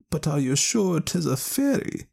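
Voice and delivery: put-on high, slow wise old woman; a knowing village wise woman. Ellen Leah asks.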